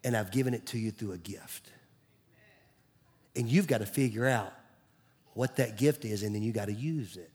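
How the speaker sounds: soft, low, intimate voice of God; God's voice, hushed. Acted. Pastor voicing God's words to his church, hushed and low. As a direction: drop to a near whisper as God speaking. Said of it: "He's saying".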